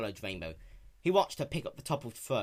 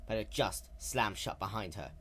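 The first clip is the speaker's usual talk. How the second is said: usual speaking voice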